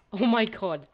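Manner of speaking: breathless shock after a close call; adrenaline rush. Real, not acted. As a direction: shout it out, heart pounding. Survived a close game fight.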